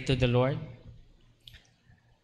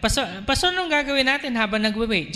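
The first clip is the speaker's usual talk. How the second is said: higher, quick asking voice; eager congregant; 7 semitones higher, faster than usual. Pretend. Congregant pestering the pastor, higher and quicker.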